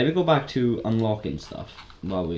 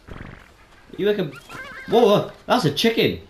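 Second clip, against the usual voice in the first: surprised; 11 semitones higher, 5 dB louder than usual. Real, not acted. Spots a surprise chicken in game.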